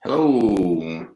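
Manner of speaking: ordinary delivery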